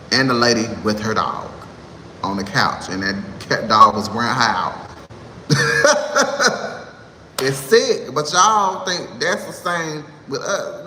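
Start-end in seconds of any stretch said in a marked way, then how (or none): 5.4-6.8 a high, airy burst of laughter; real laugh. Real, not acted. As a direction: crack up at the memory. Laughing at the memory.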